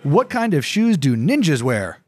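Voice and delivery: ordinary talk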